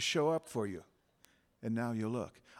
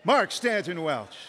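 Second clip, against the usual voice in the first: loud, slow, raised callout; calling out name; 5 semitones higher, 5 dB louder than usual. Real, not acted. Speaker leading everyone on three, loud name callout. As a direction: call your name out high and slow. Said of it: "Speak your name on three".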